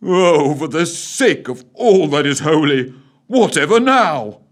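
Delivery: petulant aristocrat grumble; moaning.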